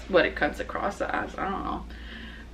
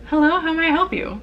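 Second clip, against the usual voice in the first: higher, quicker polite front-desk greeting; customer service voice; 10 semitones higher, 3 dB louder, faster than usual. Shown as a demonstration. Hotel clerk greeting a guest, in a higher, polite customer voice. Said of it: "should I do, like, my customer voice?".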